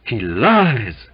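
mildly gasped accusation; a touch of breathless protest; gasping. A narrator's reading. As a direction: force it out with a gasp. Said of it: "gasped Waite".

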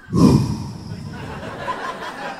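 long breathy high sigh of defeat; exasperated sigh. Real, not acted. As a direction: sigh like the news crushed you. Learns another hour to go.

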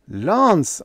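cried out. A narrator's reading. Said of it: "I cried".

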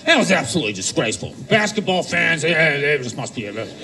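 low, fast, stiff broadcaster voice; imitated ESPN anchor. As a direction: drop low like a solemn sports anchor. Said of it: "They were like".

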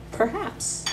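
normal talking voice

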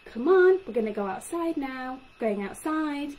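high, bright Come on, then short simple chunks; short chunks to a child. Shown as a demonstration. Speech therapist showing parents the right way, short chunks. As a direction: talk to a toddler in little pieces. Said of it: "And I'd want you to say".

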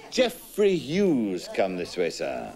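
ordinary talk

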